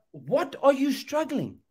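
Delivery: normal talking voice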